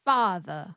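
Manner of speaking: FATHER with a schwa for the final R; dropped R. Shown as a demonstration. Student echoing coach, father without R. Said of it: "you drop the R and you make it a schwa".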